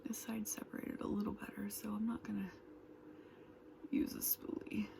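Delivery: quick soft whisper. Real, not acted. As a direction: murmur it while doing makeup. Whispered makeup chat.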